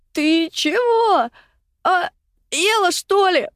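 high, strained cry of panic; panicked croak. A narrator's reading. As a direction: choke it out in deadly panic, spitting water. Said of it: "прохрипела в смертельной панике".

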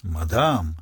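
low, drawn-out formal address; dignified. A narrator's reading. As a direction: address her with solemn dignity. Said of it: "с достоинством обратился к ней инспектор".

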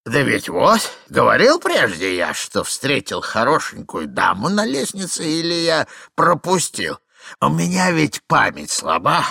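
put-on forgetful old man. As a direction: ramble on like a doddering old husband. Plays rambling old man.

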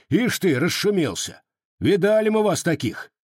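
high, indignant argument; loud defiance. A narrator's reading. Cossack loudly refusing the officer and ordering refugees out. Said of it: "громко сказал один из казаков".

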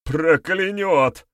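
high, slow, drawn-out cry; frightened outcry. A narrator's reading. Cornered accuser fears the witch's curse, frightened outcry. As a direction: cry out in dread of it.

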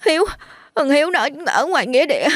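put-on high sobbing voice of a frightened child; choked sobbing. Boy telling villagers where his friend is, high and sobbing. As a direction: get it out between sobs. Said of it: "thằng Hoàng khóc nấc".

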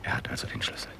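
hushed warning; whisper. Acted. Nutting sending Dickens home, whispered. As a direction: keep it barely audible. Hiding, watching the house.

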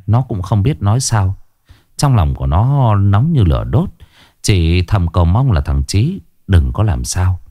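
ordinary delivery

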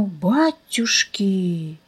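slow, soft stunned exclamation; amazed gasp. A narrator's reading. The narrator stunned by the girl's diet, soft slow amazement. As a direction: breathe it out in disbelief.

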